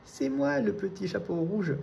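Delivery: wolf faking a tiny high little voice. A narrator's reading. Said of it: "en prenant une toute petite voix". (toute petite voix aiguë du loup déguisé)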